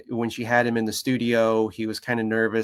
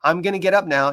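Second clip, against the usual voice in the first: higher-pitched friendly heads-up; talking to a dog; 6 semitones higher, 4 dB louder than usual. Retold or imitated. Trainer to nervous dog before moving, friendly heads-up. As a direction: sing out a gentle warning to a dog. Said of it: "I talked to him".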